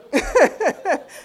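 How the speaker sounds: a burst of high, delighted laughter. Real, not acted. Laughing as she retells God's joke, delighted laugh. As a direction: let a real laugh break out high.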